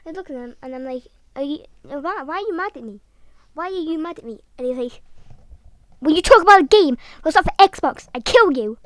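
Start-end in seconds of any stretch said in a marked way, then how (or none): 1.4-4.4 louder, higher plea; acted alarmed protest. Asking the threatening kid why, raised-voice mock plea. 6.0-8.8 very loud, raised-pitch yelled threat; hammed-up angry shout. Xbox fanboy threatening over a rival game, mock-furious shout.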